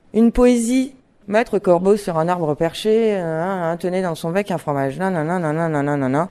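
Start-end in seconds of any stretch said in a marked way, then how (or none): 1.3-6.3 low monotone drone, trailing into mumbo-jumbo; dull droning recitation. Shown as a demonstration. Teacher shows children how not to recite poetry, sleepy drone. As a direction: recite it like a bored pupil, low and toneless. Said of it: "si vous récitez quelque chose comme ça".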